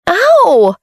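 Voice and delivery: high startled cry of pain; pained yelp. A narrator's reading. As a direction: yelp as she hits the floor. Monica trips and falls.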